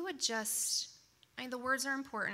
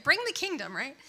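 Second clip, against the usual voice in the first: eager call; 6 semitones higher, 7 dB louder than usual. Pretend. Playful call for the kingdom.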